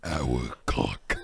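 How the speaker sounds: very low monster voice; Venom. Acted. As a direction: drop deep into a creature's voice.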